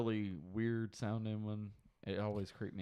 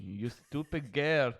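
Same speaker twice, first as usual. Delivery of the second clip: Dexter impression; 4 semitones higher, 6 dB louder, slower than usual. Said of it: "You sound more like Gru".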